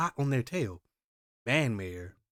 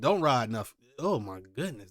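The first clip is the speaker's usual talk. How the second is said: ordinary delivery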